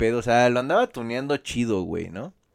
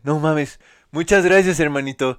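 grateful biker; 4 semitones higher, 7 dB louder than usual. Retold or imitated. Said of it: "se voltea y me dice".

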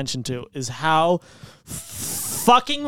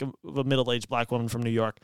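normal talking voice

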